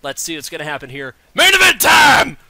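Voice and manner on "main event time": loud, high announcer yell; big arena call for the main event; hyped shout. Acted. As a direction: belt it out like a ring announcer. Commentator hyping the main event.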